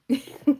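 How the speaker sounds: high, loud quick chuckle; brief burst of laughter; short laugh. Real, not acted. Amused at her own remark on buying beads, short laugh.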